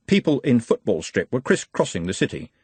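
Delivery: plain reading voice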